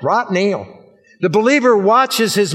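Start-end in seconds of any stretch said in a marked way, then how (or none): 0.0-0.8 RIGHT NOW drawled Southern-style, "raht nayel". Shown as a demonstration. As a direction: put on a drawn-out southern US accent. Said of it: "say that with a southern drawl".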